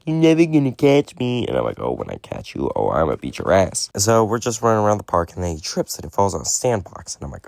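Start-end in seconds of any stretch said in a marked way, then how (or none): 0.0-1.4 high taunting kid's sing-out, imitated; mocking kid. Kid teasing the man chasing him, mocking high child voice. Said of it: "he's chuckling. He's like". 1.8-3.9 retold threat. Muttered threat to fleeing kid.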